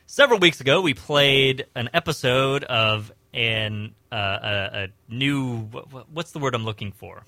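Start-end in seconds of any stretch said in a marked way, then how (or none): none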